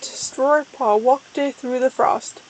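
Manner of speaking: ordinary delivery, nothing marked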